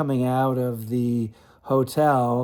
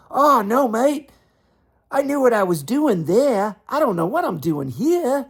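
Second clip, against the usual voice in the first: high, louder imitated Aussie protest; sheepish Aussie comeback; 11 semitones higher, 5 dB louder than usual. Rafter explaining the court is easier than TV, high loud mock Aussie. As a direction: play Rafter laughing off his nerves.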